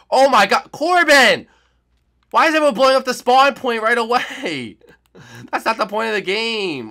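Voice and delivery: loud, high yell of disbelief; outraged shout. Real, not acted. Player yelling at Corbin for blowing up the spawn, loud outrage.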